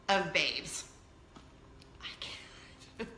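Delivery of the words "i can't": breathless whisper. Real, not acted. Mother whispering to viewers, spent from laughing.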